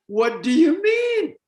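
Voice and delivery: incredulous retort. Retold or imitated. His stock answer to silly question.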